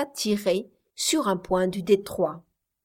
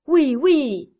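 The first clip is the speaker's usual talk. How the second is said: eager agreement; 6 semitones higher, 6 dB louder than usual. A narrator's reading. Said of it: "s'écrièrent quelques-uns des passagers".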